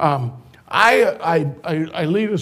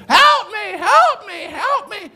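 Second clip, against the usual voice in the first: high, loud repeated cries; yelling old woman; 4 dB louder than usual. Retold or imitated. Woman in wheelchair yelling for help, high and loud. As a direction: belt out the pleas in a thin old voice.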